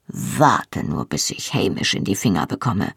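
growing wrath, held down low; grim anger. A narrator's reading. Jamie vows to get hold of Hamish, full of grim anger. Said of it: "sagte er voll Ingrimm".

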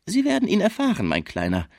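high, mocking put-down, dropping at the end; spöttisch. A narrator's reading. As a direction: taunt him from a high pitch. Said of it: "sagte Fraisier spöttisch". (spöttisch mit hoher Stimme)

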